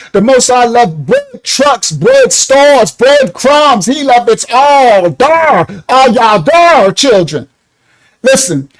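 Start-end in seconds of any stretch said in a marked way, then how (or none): 0.1-7.5 preachy sing-song. Acted. Preaching sing-song about breadcrumbs.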